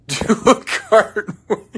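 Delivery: amused, reading a fan's dare; laughing. Real, not acted. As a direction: laugh out the silly request. Reads request, laughing.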